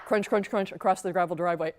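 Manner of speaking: ordinary delivery, nothing marked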